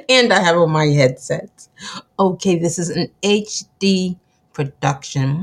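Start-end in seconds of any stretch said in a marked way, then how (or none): none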